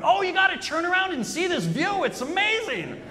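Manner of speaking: high, bright mock excitement; cheerful friend. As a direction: gush over the view like the carefree friend. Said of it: "J C is behind me going".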